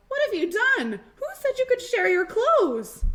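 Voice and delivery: high, loud shocked scolding; teacher scolding the children, high and loud; teacher yelling. Acted. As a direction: yell at the kids in dismay. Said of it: "The teacher yelled".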